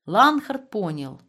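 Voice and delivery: ordinary delivery, nothing marked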